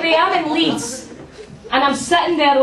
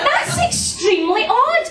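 posh mock; 11 semitones higher than usual. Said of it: "I'm like".

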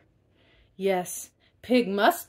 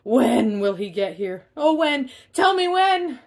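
rising, louder demanding squeal; whiny pig, climbing higher and louder; impatient pig; 5 semitones higher, 7 dB louder than usual. Acted. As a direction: pester like a child who can't wait. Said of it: "he screamed out again".

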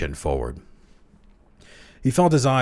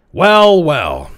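sharp, much higher and louder snap; snapped; 10 semitones higher, 12 dB louder than usual. A narrator's reading. Old Langdon snaps at the young clerk, angry and loud. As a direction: bark it out like a firecracker. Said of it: "he snapped".

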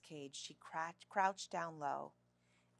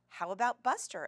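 loud, eager interruption; keen, louder cut-in; breaking in; 4 semitones higher, 9 dB louder than usual. A narrator's reading. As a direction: jump in with a suggestion, pitched up. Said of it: "Ella broke in".

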